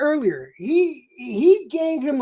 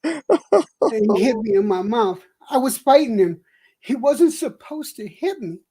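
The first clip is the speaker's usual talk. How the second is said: Stossel with loose dentures; 5 semitones lower than usual. Pretend. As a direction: lisp like his teeth are loose. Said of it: "let me see if I can act this out".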